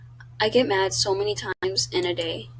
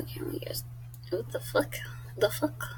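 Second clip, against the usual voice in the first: soft unvoiced exasperation; muttered aside at the screen; whispered swear; 3 semitones higher, 9 dB quieter than usual. Real, not acted. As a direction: hiss out the frustration under breath.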